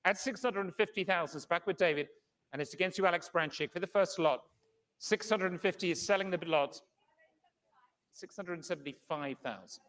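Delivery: brisk, measured bid calling; fine art auctioneer. Acted. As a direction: call the bids steadily, room to room.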